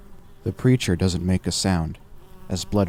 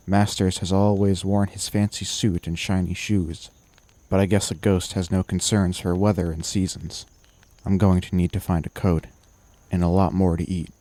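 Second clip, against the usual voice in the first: usual speaking voice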